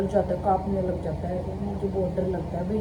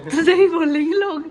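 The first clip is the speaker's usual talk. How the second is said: high, loud and laughing; laughing taunt; 8 semitones higher, 9 dB louder than usual. Real, not acted. Wife teasing husband about what people will say, laughing. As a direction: tease him loudly through a laugh.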